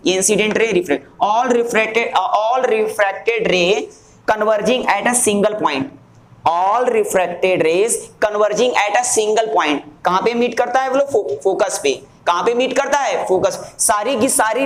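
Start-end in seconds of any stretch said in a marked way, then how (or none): 1.2-9.8 very drawn-out, measured delivery; slow dictation. Shown as a demonstration. Teacher dictating where rays converge to class, slow dictation. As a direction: dictate slowly so students can write.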